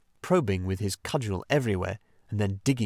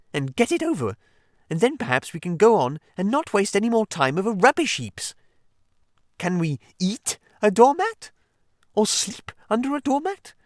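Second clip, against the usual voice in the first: higher Mole voice building louder to an exasperated outburst; Mole, growing irritation; 11 semitones higher, 5 dB louder than usual. Acted. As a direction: scold the Rat more and more. Mole's mounting irritated rant.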